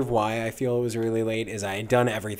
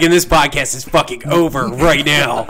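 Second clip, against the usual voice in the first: mock angry outburst; 4 semitones higher, 14 dB louder than usual. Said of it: "He's like".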